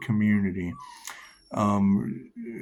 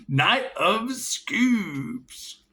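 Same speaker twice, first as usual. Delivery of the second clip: intoned card name; 9 semitones higher, much slower than usual. Acted. Announcing the drawn tarot card, slow and grand.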